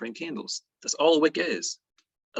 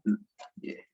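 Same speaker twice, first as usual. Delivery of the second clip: usual speaking voice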